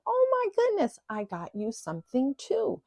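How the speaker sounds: high, gushing Texas belle; Southern belle drawl. Retold or imitated. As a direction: imitate a delighted Southern mother-in-law, starting very high. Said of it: "she's got this long, drawn-out accent".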